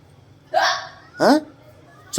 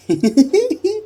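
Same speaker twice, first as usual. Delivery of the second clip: high giggle. Real, not acted. High laugh.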